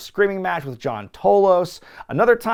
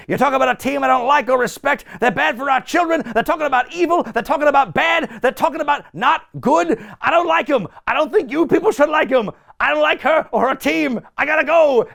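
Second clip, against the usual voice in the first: Albano rant; 9 semitones higher than usual. Retold or imitated. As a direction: preach against the team in mock outrage. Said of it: "continues on with his rant".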